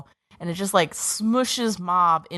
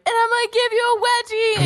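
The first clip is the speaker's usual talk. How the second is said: mock bully. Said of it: "someone out of high school ... being like".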